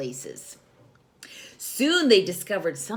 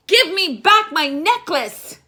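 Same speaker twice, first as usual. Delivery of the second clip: high, loud furious demand; angry wizard shout; 11 semitones higher, 11 dB louder than usual. Acted. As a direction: bellow at the thief.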